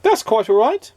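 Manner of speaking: a hint of a high, kindly stranger; lightly voiced stranger. A narrator's reading. A passer-by forgiving Mister Good, lightly voiced. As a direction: toss it off lightly as a passing man. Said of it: "said the man".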